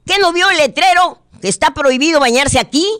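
high, loud scolding yell; angry shout. Acted. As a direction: yell like an angry park ranger. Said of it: "le grita enojado".

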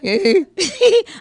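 high, loud burst of giggling; real laughter. Real, not acted. Le da risa la confusión. (risa aguda y fuerte)

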